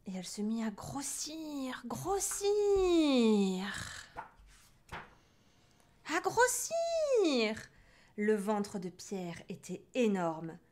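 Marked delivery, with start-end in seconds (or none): 0.7-7.6 drawn-out, rising, loud high vowels; slow ballooning crescendo; swelling. A narrator's reading. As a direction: inflate the word as it grows. Narrator mimics the witch swelling.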